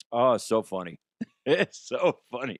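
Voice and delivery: usual speaking voice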